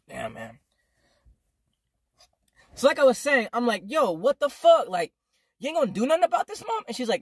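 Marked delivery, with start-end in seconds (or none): 3.9-6.8 high-pitched indignant challenge to mom; exasperated confrontation. Retold or imitated. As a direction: demand action, voice jumping up.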